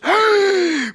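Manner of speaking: sharp high gasp of recognition; startled gasp. Retold or imitated. Old lady gasping as she recognizes him. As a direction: gasp in sudden surprise. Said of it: "She goes".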